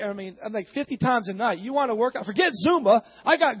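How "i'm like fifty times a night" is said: ordinary delivery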